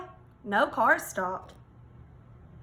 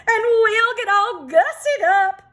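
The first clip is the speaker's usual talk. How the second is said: much higher, louder preening hens; excited hens; 10 semitones higher, 7 dB louder than usual. Acted. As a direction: squeal it like hens ready to primp. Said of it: "said the hens".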